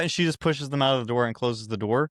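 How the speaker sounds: ordinary talk, nothing marked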